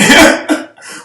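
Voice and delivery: short, high, loud laugh burst. Real, not acted. Storyteller cracking up before the heaven punchline, loud high laugh. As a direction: crack up loudly mid-joke.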